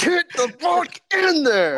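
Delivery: high, slow hammed-up yell; mock shout. Acting out fisting the toilet.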